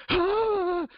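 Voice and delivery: sudden high gasp-scream of shock; a high, sharp "Ah!" of fright; startled scream. Retold or imitated. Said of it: "she went like".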